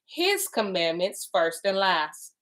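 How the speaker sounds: His leaned on, slow and weighty; His said slowly, set apart from the rest; stress on His. Shown as a demonstration. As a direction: hit the word His before commandments. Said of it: "I put emphasis on the word his".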